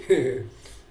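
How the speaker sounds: brief amused chuckle to oneself; small wordless laugh; short chuckle. Real, not acted. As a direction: chuckle briefly mid-thought. Speaker chuckles while recalling a saying.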